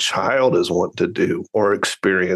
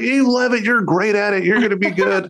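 higher, insistent dad brushing off his son; pushy sideline dad; 10 semitones higher than usual. Retold or imitated. Dad insisting to his son he loves the sport. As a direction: push him hard, won't hear no. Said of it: "I was like".

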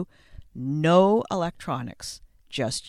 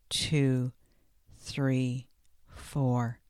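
calm, slow breathing coach counting; guided breathing; 3 semitones lower, slower than usual. Acted. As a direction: count the breaths slowly and calmly. Leads box breathing exercise.

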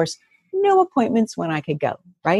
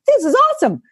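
excited; 3 dB louder than usual. Retold or imitated. Retold delight at deal.

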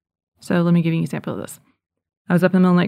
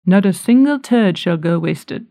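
louder, hammed-up English accent; British accent; 5 dB louder than usual. Shown as a demonstration. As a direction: proclaim it in an amateur British accent. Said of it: "in, like, in a British accent".

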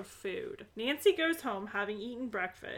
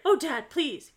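raised, louder mock plea; pleading; 4 semitones higher, 7 dB louder than usual. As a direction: beg Dad in hammed-up alarm. Acted daughter pleading with father.

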